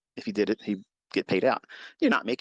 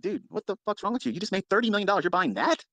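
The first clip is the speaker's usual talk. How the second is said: higher, scoffing dude voice; mocking complaint; 8 semitones higher than usual. Neighbours scoffing at the new rich man, mock disbelief. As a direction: sneer it like the neighbours would. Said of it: "everybody complained and said".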